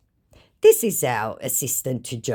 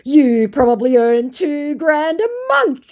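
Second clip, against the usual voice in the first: mocking put-down; 8 dB louder than usual. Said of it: "To which I said".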